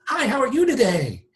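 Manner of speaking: hyper enthusiastic salesman. Retold or imitated. Agent mocking a fake cheerful greeting to a caller, very high. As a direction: overdo the cheery sales pitch. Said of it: "you greet them with".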